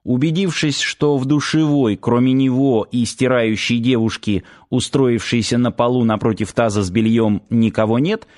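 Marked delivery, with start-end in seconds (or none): none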